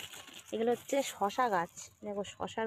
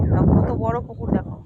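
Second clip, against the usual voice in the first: loud; 12 dB louder than usual. Real, not acted. Proudly showing big pond.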